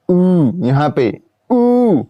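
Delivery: ऊ drawn out very slowly, placed at the back; vowel demo. Shown as a demonstration. Teacher demonstrating back vowel ऊ to students, slow and drawn out. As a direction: stretch ऊ long from the back of the tongue. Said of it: "आप उच्चारित करके भी देख सकते हैं".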